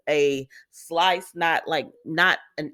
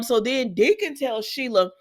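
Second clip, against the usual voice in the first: ordinary delivery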